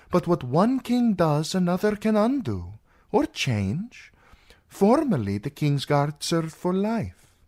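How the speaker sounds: lifted, lilting character voice; persuasive princess. Acted. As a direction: coax the knight with reasoned argument. Princess reasons with her lover.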